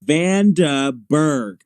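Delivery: "Vandenberg" drawn out slowly as Van-duh-berg; sounded-out name. Shown as a demonstration. Presenter passing on how the weather station says it, sounded out. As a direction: sound out "Vandenberg" in three separate beats. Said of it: "they say Vandenberg".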